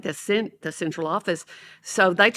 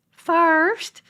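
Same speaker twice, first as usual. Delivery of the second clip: put-on high-pitched little girl reply; small high child voice; 10 semitones higher than usual. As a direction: pipe it up like a first grader. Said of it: "one of the little girls said".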